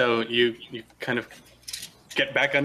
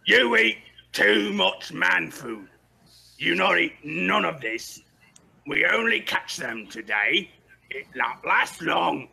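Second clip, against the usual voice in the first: slow commanding bark at the goblins; bugbear voice; 6 semitones higher, slower than usual. Acted. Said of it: "He's like".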